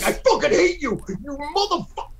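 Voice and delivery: high pitched hammed-up hatred; fake-furious outburst at a pal; mock fury. Stoner acting out a mood swing with mock fury. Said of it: "how insane it is".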